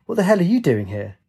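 taken aback. A narrator's reading.

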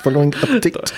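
high-pitched South African imitation; teasing echo in a South African accent; mock South African accent. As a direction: parrot it back in exaggerated South African. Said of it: "in a South African accent".

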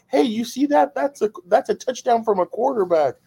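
high taunting brag; trash-talking back. Retold or imitated. Dago firing back at Philly fans, high playful taunt. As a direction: rub the touchdown in the heckler's face. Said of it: "Dago would turn around and be like".

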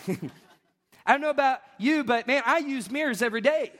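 ordinary delivery

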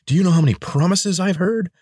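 bitter at every broken promise; angry scorn. Acted. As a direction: Lena scorning the prisoner's promises. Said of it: "she hissed".

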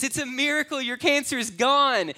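elated proclamation of a miracle; jubilant doctor. Retold or imitated. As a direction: burst in with the miraculous news. Doctor bursts in shouting.

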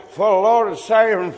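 put-on loud old woman's prophetic proclamation; mock prophesying. Old woman prophesying over the preacher, loud. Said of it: "she starts going".

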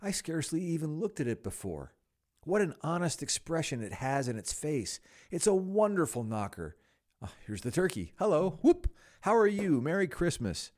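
high-pitched, bubbling delight; giddy admiration building to loud hellos; overjoyed Scrooge. Acted. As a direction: exclaim with rising glee, then call out greetings. Scrooge delights and hails arrival.

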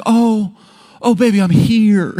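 hammed-up comforting wife; mock doting wife. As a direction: coo over a crying husband. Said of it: "she's like".

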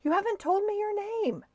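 high-pitched call to someone far off. A narrator's reading. Man asking the departing sailor his name, calling out. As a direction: call it out high, as if over water. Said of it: "yelled the man".

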